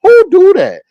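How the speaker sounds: high, loud disbelieving cry; Who do that, thrown up high and loud in disbelief; shocked yelp at a selfish teammate; incredulous outburst. Retold or imitated. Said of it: "I was like".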